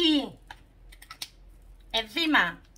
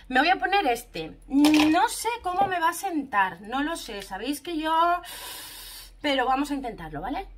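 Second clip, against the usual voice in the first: ordinary delivery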